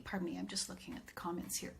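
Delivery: fast, hushed aside; real whispered aside while checking comments. Real, not acted. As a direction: mutter it off to the side, quickly. Aside while reading live comments.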